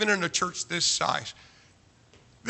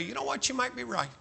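higher, softer retold reply; mild concession; 6 semitones higher, 7 dB quieter, faster than usual. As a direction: concede it softly in a high voice. Acts out his gentle concession.

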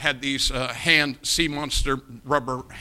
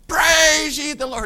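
loud, high, drawn-out call; hammed-up praise shout, slow and high; exaggerated cheerleading; 12 semitones higher, 7 dB louder, slower than usual. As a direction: stretch it out like rallying a team. Prompting his team in the contest.

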